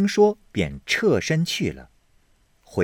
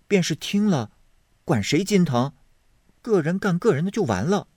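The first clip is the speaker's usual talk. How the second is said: higher, quick and careless; dismissive maid; 9 semitones higher than usual. Acted. Zhuier shrugs off Hongyu's worry, brisk and light. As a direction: brush it off lightly.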